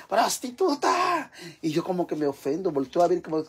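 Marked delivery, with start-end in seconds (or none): 0.1-1.3 high, drawn-out mock catcall; imitated street yell. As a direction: imitate someone yelling from down the street. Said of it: "Siente que le griten". (grito callejero imitado, agudo y alargado)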